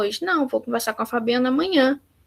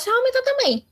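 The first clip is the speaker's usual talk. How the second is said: raised voice; 12 semitones higher, faster than usual. Shown as a demonstration. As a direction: voice jumps much higher on the last words. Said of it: "aumenta o tom de voz".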